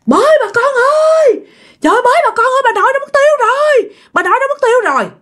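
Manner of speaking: high-pitched alarmed cry for the neighbours; la làng. A narrator's reading. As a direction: cry out the alarm high and drawn to the whole village. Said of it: "nó la làng lên". (tri hô thất thanh, giọng cao vút)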